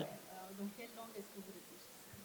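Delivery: soft, quick whisper close to the ear; whispered interpreting. Real, not acted. Interpreter murmuring the audience question to the author, hushed whisper. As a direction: murmur the translation so only he hears.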